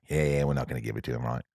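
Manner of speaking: put-on low, hushed, quick muttering in New Zealand accent; secretive low murmur, rattled off fast; huddled in a corner. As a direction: imitate a crew conferring under their breath. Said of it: "they get in a corner and they're like".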